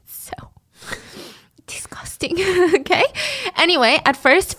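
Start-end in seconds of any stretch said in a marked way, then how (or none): 0.1-3.0 unvoiced laughing whisper; whispered disgust. Real, not acted. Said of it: "aside whisper".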